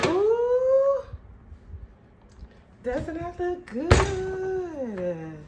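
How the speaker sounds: awed admiration; delighted ooh. Real, not acted. Admiring the finished croissant.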